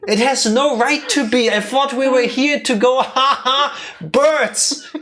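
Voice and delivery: high, loud rant of disbelief; exasperated outburst. Real, not acted. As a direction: complain at full pitch about being moved. Exasperated rant at moving game.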